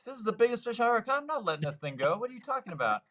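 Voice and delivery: high, fast and laughing; a kid who won't let go of his prize fish; excited protest. Retold or imitated. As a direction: boyish disbelief at being told to let it go. Boy refusing to release fish.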